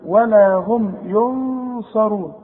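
slow, drawn-out Quran recitation with a nasal hum on the nun. Shown as a demonstration. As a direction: chant it slowly, holding the ghunna. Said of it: "يبقى حغن وحخفي". (تلاوة بطيئة مع الغنة)

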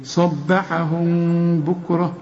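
slow صبحهم, long hum on the meem before بكرة; hidden meem. Shown as a demonstration. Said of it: "جات لنا مرة أخرى". (صبحهم بكرة بإخفاء الميم)

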